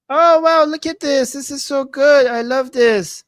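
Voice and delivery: high, loud delighted gush; thrilled by a sweet message; excited. Real, not acted. Delighted reading a chat comment.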